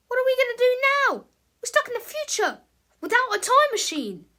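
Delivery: frantic, stuck in the future; panicked. Acted. George panics to Harold about being stranded, high and loud.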